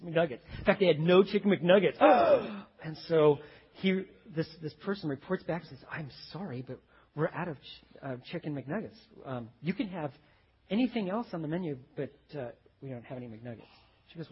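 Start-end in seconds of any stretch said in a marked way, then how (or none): 2.0-2.8 loud theatrical gasp; mock shocked gasp. Customer learning there are no McNuggets, mock shocked gasp. 5.9-13.6 soft, hesitant mock apology; lightly voiced sorry cashier; apologetic employee. Employee telling customer the food is out, hesitant apology.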